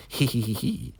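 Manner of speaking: soft, high tee-hee; put-on little giggle; imitated giggling. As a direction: titter gleefully as the unhinged woman. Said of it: "she's just laughing".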